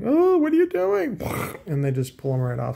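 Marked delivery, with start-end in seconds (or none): 0.0-1.2 very high, squeaky mock voice of a startled amplifier; amplifier voiced. Amplifier protesting its shields being ripped off, squeaky and alarmed. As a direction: give the gear a tiny alarmed cartoon voice. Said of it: "the amplifiers are like".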